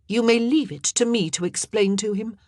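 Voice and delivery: queen firmly promising to face the angry father, strident. A narrator's reading. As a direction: take charge firmly. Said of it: "her tone unusually strident".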